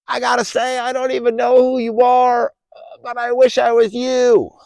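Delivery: high, loud call across the water, slowed on the compliments; loud, high hail to a distant creature; yelling across a river. Acted. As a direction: shout it over a raging river in awe. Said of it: "he yells out over the river".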